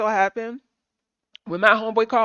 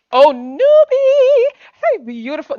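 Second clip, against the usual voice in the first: much higher, louder and slow, stretched out; delighted greeting; 12 semitones higher, 11 dB louder, slower than usual. Real, not acted. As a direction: sing out her name in delight.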